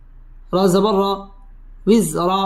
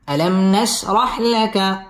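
ordinary talk

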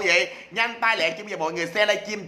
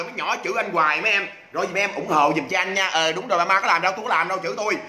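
usual speaking voice

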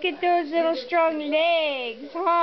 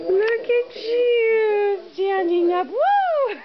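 excited; 6 semitones higher, 4 dB louder than usual. Real, not acted. Baby stands up for first time.

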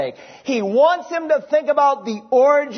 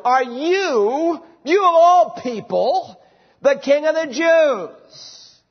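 "you" raised high and pushed, the rest drawn out slowly in disbelief; astonished Pilate; 4 semitones higher, slower than usual. Acted. Pilate astonished, questioning the prisoner Jesus in disbelief. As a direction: Pilate can hardly believe it of this man. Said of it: "contains an element of astonishment".

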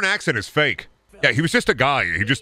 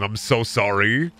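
normal talking voice

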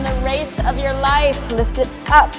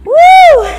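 loud high whoop; excited whoop; 17 dB louder than usual. Real, not acted. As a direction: whoop to fire up the class. Instructor cheering mid-workout.

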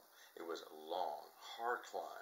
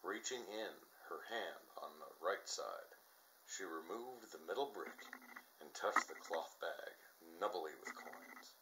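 usual speaking voice

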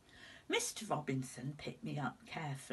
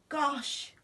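much higher, louder startled gosh; shocked exclamation; 8 semitones higher, 4 dB louder than usual. Acted. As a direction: cry Gosh out in alarm. Shocked by reflection.

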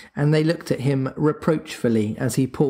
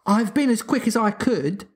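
put-on lightly breathless, higher-pitched child; a touch of puffed-out hurry; panted; 9 semitones higher than usual. A narrator's reading. Wendy to father after fetching medicine, mildly panted. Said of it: "she panted".

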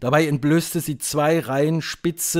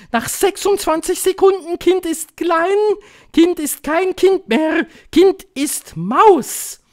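high, loud witch incantation; victorious, high-pitched shouted announcement; triumphant witch; 8 dB louder than usual. A narrator's reading. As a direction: proclaim each step in triumph. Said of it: "rief die Hoch- und Großmeisterhexe triumphierend".

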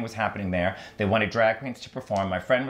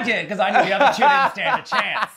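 laughing through a cheeky boast, louder and higher; 11 semitones higher, 7 dB louder than usual. Real, not acted. Joking to the host about beating rivals, laughing. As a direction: brag it while cracking up.

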